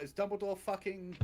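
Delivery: ordinary talk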